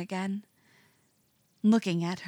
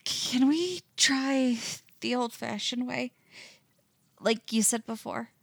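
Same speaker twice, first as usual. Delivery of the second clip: unsteady breath; 4 semitones higher than usual. A narrator's reading. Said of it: "hauling in an unsteady breath".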